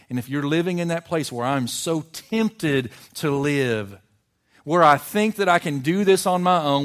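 loud, high, rising pulpit climax; preaching at full force. Acted. Pastor warning his church against going alone, loud full-force preaching. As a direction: build it up and drive it home.

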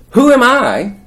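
very high, loud disbelief; incredulous protest. Acted. Moses protests to God.

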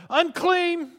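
high, loud cry of warning; screamed warning. Acted. Leper warning anyone who gets close, screamed out. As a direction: scream it out to keep people away. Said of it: "They had to scream out".

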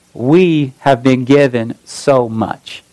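slow, weighty delivery word by word; slow emphasis. Acted. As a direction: let each word land, slowly. Preacher stresses God's gifts.

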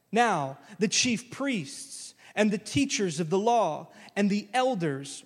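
ordinary talk

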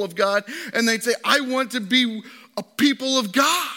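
high, loud, rising preacher's fervour; full-force preaching. Real, not acted. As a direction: preach it out, building to a cry.

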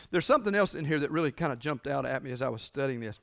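usual speaking voice

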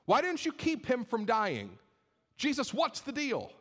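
high, forceful, reproachful outburst; frustrated accusation. Acted. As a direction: demand answers from Jesus, pitch pushed high. Acting out upset Martha confronting Jesus.

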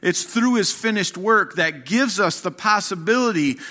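rousing sermon delivery; full-force preaching. Acted. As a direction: build it like a pulpit crescendo.

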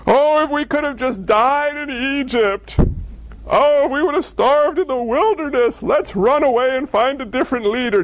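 hammed-up whining about the desert; whiny complaining Israelites. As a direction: moan like ungrateful wanderers. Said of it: "That's what we say".

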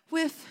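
put-on high little-kid "Whiff", with f for th; "Whiff" in a high, cute child voice; cute child's mispronunciation. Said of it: "She says, "Whiff," which is so cute".

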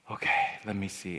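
soft, low, hushed imitation of a mom; subdued mother. Mom opens purse for child's book money, quiet subdued voice. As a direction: say it small and quiet, holding back. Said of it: "it was heartbreaking to see my mom go".

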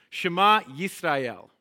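slow call, "Shema Yisrael" with the Y sounded; slow Hebrew call. Shown as a demonstration. Teacher calling out Hebrew for the congregation to repeat, slow and clear. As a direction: lead the call slowly for them to echo. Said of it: "just respond to what I say".

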